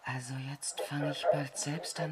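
hushed, unnerved whisper; whispering in awe of the strange signal; uneasy whisper. Acted. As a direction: whisper it as if spooked.

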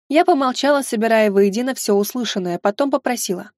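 usual speaking voice

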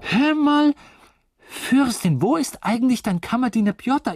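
high, loud hail across the compartment; loud, high-pitched tipsy interrogation; insistent calling out. Acted. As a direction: demand an answer, pitched right up. Suddenly hails and questions the princess.

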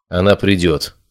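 ordinary delivery

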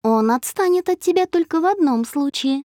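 high, lilting, secretive hint; mysterious. A narrator's reading. Friend hints at a cure for Dasha's ex, mysterious. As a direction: tease it out like a secret recipe. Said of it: "загадочно".

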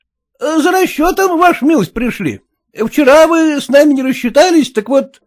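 put-on high pleading village girls asking for their pay; high, insistent peasant girls; peasant girls' voice. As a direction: pitch it high like a chorus of girls wanting their money.

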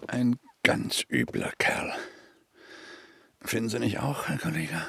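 hushed aside to a fellow officer; whispered aside. Acted. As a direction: lean in and murmur it just for your colleague. Aside to colleague about suspect.

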